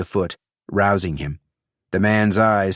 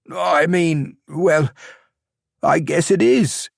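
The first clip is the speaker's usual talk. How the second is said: higher, hesitant and baffled; stunned young man; 9 semitones higher, slower than usual. A narrator's reading. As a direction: stumble into reluctant agreement.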